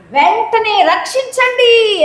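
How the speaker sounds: high call for rescue; acted cry for help. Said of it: "అని కేకలు వేశాడట".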